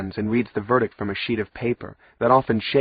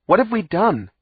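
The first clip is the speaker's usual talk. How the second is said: yelled; 10 semitones higher, 4 dB louder than usual. A narrator's reading. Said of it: "Wisty suddenly yelled at the judge".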